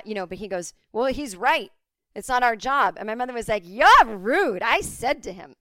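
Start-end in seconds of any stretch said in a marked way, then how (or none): 0.9-2.9 louder, dismissive cop; loud mock cop shutting mom down; retold curt cop. Cop's retold reply. 3.7-5.6 loud, high, indignant scolding; hammed-up offended mother; retold indignant mom. Mom confronts cop.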